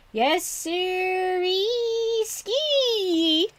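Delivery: slow, high, stretched-out put-on voice; dragged out. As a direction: drag every syllable out long. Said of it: "you're supposed to drag it all out".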